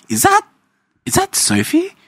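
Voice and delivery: spotting someone at church, squeaky mock surprise. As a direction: squeal it in a disbelieving high voice.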